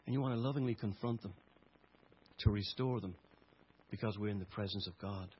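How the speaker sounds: soft, low, gentle plea; tender appeal. Acted. Quiet appeal to congregation.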